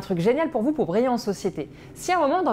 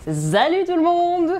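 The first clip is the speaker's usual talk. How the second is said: big silly greeting; 8 semitones higher, 5 dB louder, slower than usual. Acted. Exaggerated greeting.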